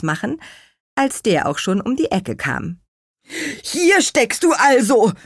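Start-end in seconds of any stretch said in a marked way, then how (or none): 3.6-5.1 high, loud relieved exclamation; relieved call. A narrator's reading. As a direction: call out with relief at finding her. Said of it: "rief er erleichtert".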